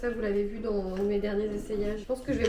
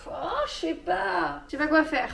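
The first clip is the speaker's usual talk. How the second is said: mock despair; 5 semitones higher, slower than usual. Hesitating over a dress.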